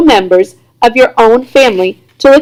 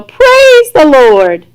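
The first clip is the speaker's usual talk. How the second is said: very slow, loud praising cry; rejoicing; 5 semitones higher, 3 dB louder, much slower than usual. A narrator's reading. Grandfather rejoicing at the news, slow loud praise. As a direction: draw it out like a revival shout.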